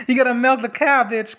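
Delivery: cracking up as she repeats it; laughing. Real, not acted.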